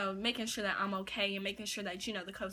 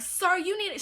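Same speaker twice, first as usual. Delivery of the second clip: higher, louder retold yell; 9 semitones higher, 7 dB louder than usual. Manager ordering a customer off, retold yell. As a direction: raise it like a manager scolding. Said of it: "she was just yelling".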